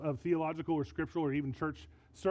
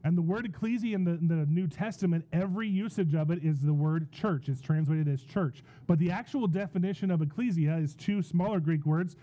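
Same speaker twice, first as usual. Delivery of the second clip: preaching at full force; 6 dB louder than usual. Real, not acted.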